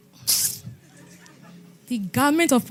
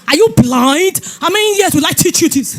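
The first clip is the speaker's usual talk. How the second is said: loud, raised rebuke with some laughter; angry husband; 3 semitones higher, 9 dB louder than usual. Retold or imitated. Husband yells at his wife over the car, loud and angry. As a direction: tear into her like a furious husband.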